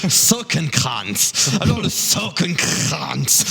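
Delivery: Sockenkranz drawn out slowly, German style; careful German word. Shown as a demonstration. Host to guests, slow careful Sockenkranz. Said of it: "vous avez tous tort".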